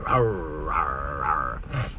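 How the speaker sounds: low, growly dinosaur roars; mock monster growl; growling T-Rex. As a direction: growl like a pirate-ish T-Rex. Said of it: "sound like ... old school pirate".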